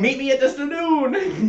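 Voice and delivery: high, loud, slow hammed-up cowboy through a laugh; mock cowboy voice. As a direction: ham up a cowboy line for a laugh. Joking about cowboys, laughing.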